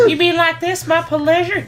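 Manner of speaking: high girlish attempt at "my pleasure"; squeaky first try. Girl trying the line for the manager, squeaky high. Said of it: "No, no, do it more soft".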